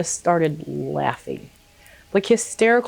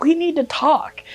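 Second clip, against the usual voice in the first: high, pressing retold announcement; urgent announcement; 10 semitones higher than usual. Retelling how she broke it to her boyfriend, mock urgency.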